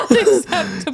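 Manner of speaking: high, laughing repeat of the line; laughing echo. Retold or imitated. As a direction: echo it while cracking up.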